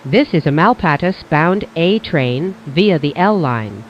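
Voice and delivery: ordinary delivery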